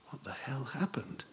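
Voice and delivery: unvoiced, hushed question; whisper. A narrator's reading. Boy's unspoken question to bruised teacher, whispered. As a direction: breathe it with no voice.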